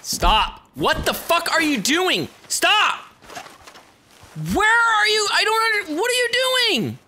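high, frantic yelling in disbelief; exasperated shouting. Real, not acted. Player ordering a runaway to stop, exasperated shouting.

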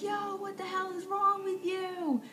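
high, drawn-out mock yell; outraged challenge without real volume; imitated angry boyfriend. Acts out boyfriend yelling at driver.

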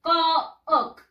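"Cook" read as written, "ko-ok", loud; spelling pronunciation. Shown as a demonstration. Teacher showing pupils the spelling, "Cook" sounded as "ko-ok". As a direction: split the double O in "Cook", high and loud. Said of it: "lo vamos a escribir así".

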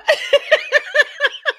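long high-pitched giggling fit; burst of high laughter. Real, not acted. Laughing at the audiobook.

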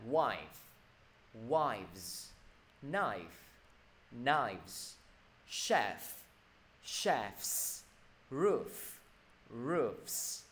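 each word from Wife to roofs alone, endings clearly sounded; model word list. Shown as a demonstration. Teacher reading back for students, Wife to roofs slowly enunciated. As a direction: pause between Wife, wives, knife and the rest, releasing final consonants. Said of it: "thầy đọc lại nào".

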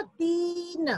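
तीन with a long, held ee; long vowel. Shown as a demonstration. Teacher answering her question to children, drawn-out तीन. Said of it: "क्या बन गया बच्चों?".

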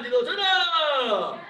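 long drawn-out drill call; sharp martial arts commands. Real, not acted. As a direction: call the count to the whole class, drawn out. Instructor calls out kick count.

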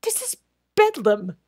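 loud, exasperated outburst; angry exasperation. Acted. Crutchley to the waiter, angry and exasperated. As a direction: burst out in helpless anger. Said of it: "laughed out in angry impotence".

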